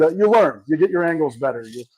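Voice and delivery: ordinary talk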